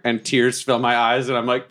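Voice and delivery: ordinary talk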